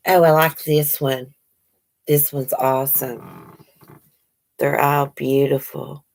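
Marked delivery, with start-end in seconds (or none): none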